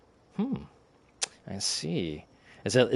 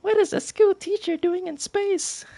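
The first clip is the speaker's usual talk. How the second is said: very high, squeaky put-on voice; trying a squeaky voice; 4 dB louder than usual. Grace cycling through voices, very high squeaky voice. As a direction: squeak it out like a chipmunk. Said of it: "trying to go through various voices".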